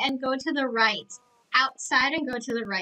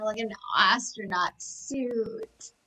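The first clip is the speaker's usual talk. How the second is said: ordinary talk, nothing marked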